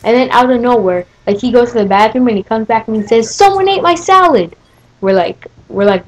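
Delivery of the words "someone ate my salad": high-pitched mock accusation; mocking high kid voice; whiny accusing kid. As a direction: imitate an indignant boy, voice pitched way up. Mocking the boy's false accusation.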